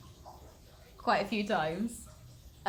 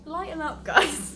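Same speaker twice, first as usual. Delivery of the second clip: laughing tease; 7 semitones higher, 7 dB louder than usual. Real, not acted. Laughing teasing the hikers.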